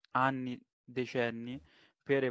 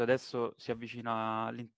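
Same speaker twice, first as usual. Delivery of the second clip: usual speaking voice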